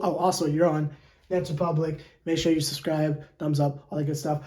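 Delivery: low, soft throwaway plug; quiet aside. Real, not acted. Host slipping in a subscribe plug, quiet aside.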